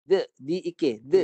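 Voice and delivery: D, d, i, k, d spelt out loud, each d voiced; voiced d drill. Shown as a demonstration. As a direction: drill the sounds D, d, i, k, d, voicing each d. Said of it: "Guna suara".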